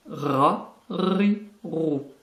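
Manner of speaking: "Ra, ri, rou" with a long trilled R; rolled R. Shown as a demonstration. Teacher demonstrating the letter ra, rolled R. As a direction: roll the tongue hard on "Ra, ri, rou". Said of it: "C'est un R qui est roulé".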